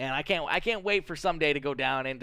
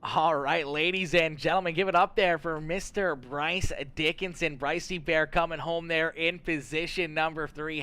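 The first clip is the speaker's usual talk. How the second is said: raised, higher announcer voice; big trackside introduction, pitched up and punchy; race announcer; 3 semitones higher, 4 dB louder than usual. Acted. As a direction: hype up the finisher for the crowd. Host announces finisher to audience.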